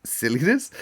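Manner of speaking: questioning rise on silliness; catching her own slip with a lift; puzzled at own misreading. Real, not acted. Reader stops, puzzled by her slip.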